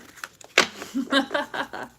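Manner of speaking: real embarrassed giggle; laughing at the pineapple card. Real, not acted. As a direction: giggle it away, can't say it. Can't say it, giggling.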